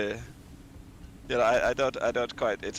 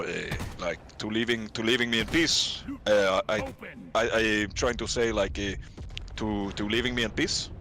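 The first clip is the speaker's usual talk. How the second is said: put-on low, flat French accent, no up and down; 4 semitones lower than usual. Frenchman complaining about his boss's email, flat French accent. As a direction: keep the French accent subdued and level in tone. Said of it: "French sound very flat, no up and down".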